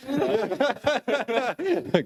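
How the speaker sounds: bright high laugh; real amused laugh; laughter. Real, not acted. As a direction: laugh along at the joke. Laughs at the answer.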